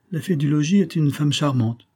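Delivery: ordinary delivery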